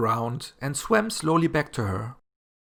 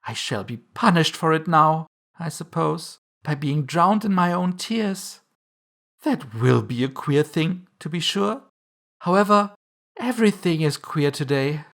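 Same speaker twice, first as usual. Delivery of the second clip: lightly voiced girl, pitched higher; a higher, mildly fretting storybook girl; rueful Alice; 5 semitones higher than usual. A narrator's reading. Alice talks to herself while swimming in tears, mildly rueful.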